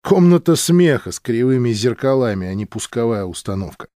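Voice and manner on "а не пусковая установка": ordinary delivery, nothing marked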